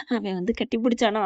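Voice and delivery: ordinary delivery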